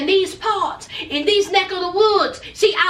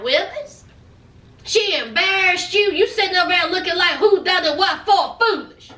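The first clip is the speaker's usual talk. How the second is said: ordinary delivery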